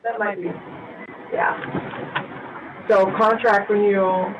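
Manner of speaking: mic echoing through the hall. Real, not acted. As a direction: voice booming with room echo. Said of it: "I'm echoing".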